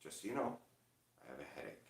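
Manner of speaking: very soft, subdued imitation; hushed, low-energy warning; quiet headache warning. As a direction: murmur it as if your head hurts. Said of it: "she's like".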